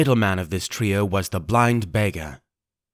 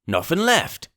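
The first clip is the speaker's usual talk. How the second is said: higher rough report; pirate voice; 7 semitones higher than usual. Acted. Searcher tells Pugh the body is empty, rough. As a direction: report it gruffly.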